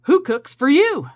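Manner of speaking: high, loud hoot of who cooks for you; loud owl call. Shown as a demonstration. Urging viewers to call to the owls, loud owl call. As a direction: call it out like a barred owl in the dark. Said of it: "Why don't you say".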